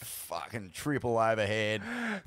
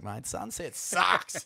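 deflated grumble; 5 semitones higher, 3 dB quieter, slower than usual. Retold or imitated. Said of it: "I was like".

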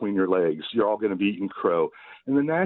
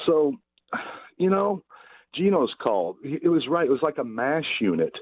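ordinary talk

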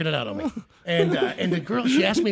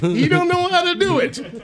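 much higher, slow singsong jeer; high mocking taunt; 11 semitones higher, 3 dB louder, slower than usual. Coworker taunting the new guy every day, slow high jeer. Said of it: "I worked there for two months".

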